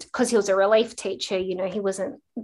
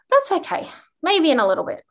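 gentle encouragement; 7 semitones higher, 4 dB louder, faster than usual. Retold or imitated. Gently encouraging the reluctant boy.

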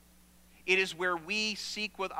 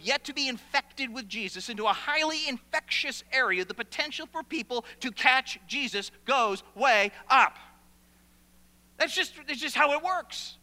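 rising, forceful preaching voice; 5 semitones higher, 4 dB louder than usual. Acted. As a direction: build it up like a revival preacher. Preacher builds to full force.

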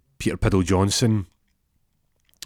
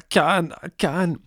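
scared refusal; 10 semitones higher, slower than usual. Retold or imitated. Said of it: "And Peter's like".

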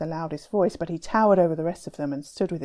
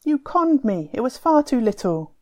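higher, louder bitter charge; angry accusation; 6 semitones higher, 5 dB louder than usual. Acted. Tufan accusing Timur over the bean price, angry. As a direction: insist you were cheated.

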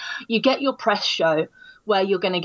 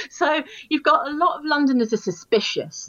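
ordinary delivery

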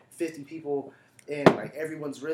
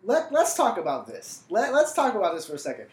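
louder and higher, getting heated; fired-up rant; 5 semitones higher, 7 dB louder than usual. Real, not acted. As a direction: build up into an indignant rant. Heated rant about offended audiences.

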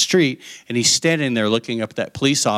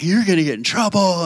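higher-pitched mock sibling warning; big-brother taunt; 6 semitones higher than usual. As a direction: needle them like a tattling brother.

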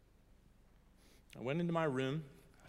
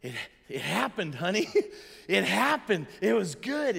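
excited joy; 5 semitones higher, 7 dB louder than usual. Retold or imitated. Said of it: "I can hear it in your voice".

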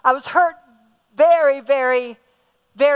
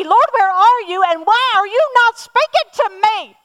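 desperate pleading prayer; 6 semitones higher, 4 dB louder than usual. Retold or imitated. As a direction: pour it out to God, rising to a desperate outcry. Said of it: "I would say to Leslie".

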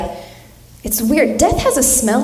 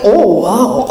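echoing dying grandma; 2 semitones lower, 4 dB louder than usual. Retold or imitated. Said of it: "and I was like".

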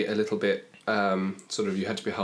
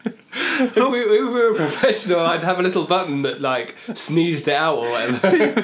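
laughing; 7 semitones higher, 5 dB louder than usual. Real, not acted. Laughing after the choking noise.

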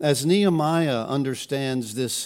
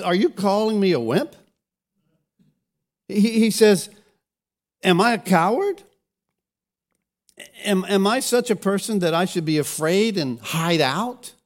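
louder, indignant rhetorical challenge; defiant Nehemiah; 7 semitones higher, 5 dB louder than usual. Retold or imitated. Nehemiah refusing to hide from his enemies, indignant and louder. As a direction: fire back the question, offended.

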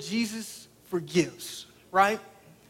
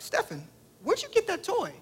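mom's voice pitched much higher, soft and probing; suspicious mom voice; 12 semitones higher, 5 dB quieter than usual. Retold or imitated. Preacher voicing his wife questioning their son, high and suspicious. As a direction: imitate the suspicious mother, up high and quiet. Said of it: "She's like".

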